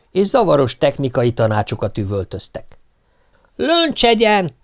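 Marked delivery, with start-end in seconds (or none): 3.2-4.5 high, slow bossy order; commanding shout. Acted. The fireworker silencing the crowd, commanding shout. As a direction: shout for silence like a drill sergeant. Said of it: "parancsolta a tűzmester".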